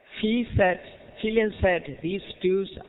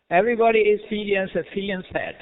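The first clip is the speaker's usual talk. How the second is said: ordinary talk, nothing marked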